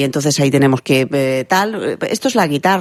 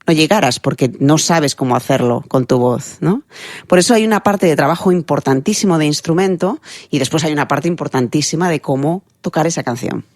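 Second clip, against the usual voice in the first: ordinary talk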